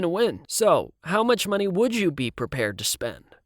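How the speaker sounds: talking his way out of trouble; a hint of a slick producer. A narrator's reading. Producer haggles with Siv.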